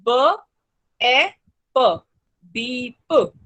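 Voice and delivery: ब, ए, प said apart, then बीप; phonics sounds. Shown as a demonstration. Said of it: "हम बोलेंगे". (ध्वनियाँ ब ए प, फिर बीप)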